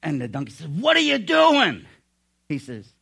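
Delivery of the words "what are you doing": high, loud yell of protest; outraged yell. Acted. Donkey yelling at the camel that drank the water, outraged. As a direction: yelp it in loud, high disbelief.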